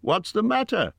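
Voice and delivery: high-pitched call across the gloom. A narrator's reading. Hobbit asks the wailing creature what's wrong, calling out. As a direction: call it out with urgency. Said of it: "Bilbo called".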